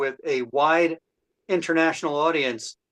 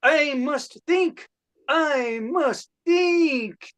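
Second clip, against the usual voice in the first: higher and dragged out slowly; slowing Rodin pondering; 8 semitones higher, slower than usual. Acted. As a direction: stretch each word as the thought stalls. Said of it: "my impression of ... Rodin having a creative block".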